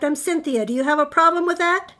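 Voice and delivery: loud, high, challenging monster; touchy girl monster, rising and loud; snapped. A narrator's reading. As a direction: challenge the kid indignantly. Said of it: "she snapped".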